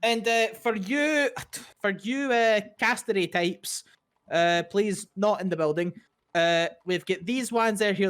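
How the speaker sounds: high, quick Irish shopkeeper; chirpy Irish sales pitch; Irish twang. Acted.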